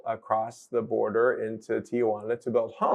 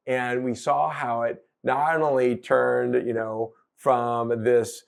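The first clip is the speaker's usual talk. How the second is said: ordinary talk, nothing marked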